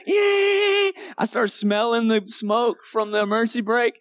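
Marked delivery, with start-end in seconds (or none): 0.1-0.9 long, high held squeal; high-pitched mock tire screech; screeching vehicle imitation. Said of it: "I'm just like".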